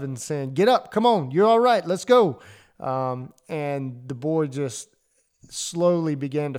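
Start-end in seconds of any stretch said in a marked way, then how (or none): 0.5-2.4 much higher, loud, hard sideline shouting; tough-love yell. Retold or imitated. Dad still shouting at his crying son to get up, loud and high. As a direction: bark it at the crying boy, no sympathy. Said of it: "giving him some tough love".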